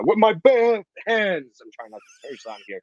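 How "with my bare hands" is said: slow, higher mock Rocky; lightly voiced Rocky Balboa; Rocky line. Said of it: "as Barry would say".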